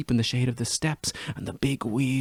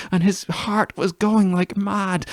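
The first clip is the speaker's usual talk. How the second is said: breathless rapture; 7 semitones higher, 3 dB louder, slower than usual. Acted. Ecstatic memory of embrace.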